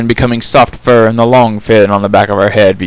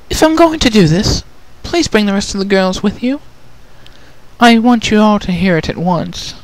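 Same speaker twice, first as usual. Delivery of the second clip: high, gentle refined lady, a touch sad; Rarity, sad; 11 semitones higher than usual. Acted. Rarity asks about her sister, gently sad.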